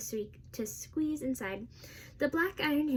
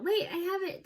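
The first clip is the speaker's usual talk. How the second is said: higher-pitched, contained yell; mild yell; 7 semitones higher than usual. A narrator's reading. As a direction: call out urgently without full volume. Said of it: "heard someone yell".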